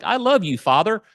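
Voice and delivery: high, angelic suck-up son; Eddie Haskell. Retold or imitated. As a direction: imitate a kid playing the little angel to Dad. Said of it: "They turn into Eddie Haskell".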